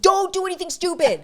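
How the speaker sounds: alarmed warning. Retold or imitated. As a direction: act out a friend's urgent warning. Retold friend's alarmed warning.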